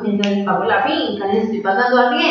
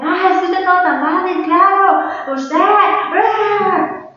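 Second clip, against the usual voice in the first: high, mock-outraged mom voice; high-pitched mimicry of an upset mother; retold indignant mother; 9 semitones higher, 3 dB louder, much slower than usual. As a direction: imitate mom's exasperated outburst, ending in a cry. Retelling mother's indignant reply.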